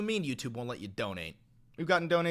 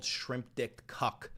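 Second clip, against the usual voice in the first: drawn-out, slow mocking insult; 3 semitones lower, 5 dB quieter, much slower than usual. Real, not acted. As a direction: spell out each word slowly.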